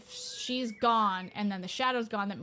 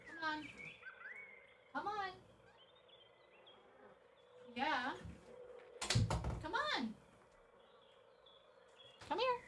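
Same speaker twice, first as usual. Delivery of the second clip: coaxing the cat; 8 semitones higher, 12 dB quieter than usual. Real, not acted.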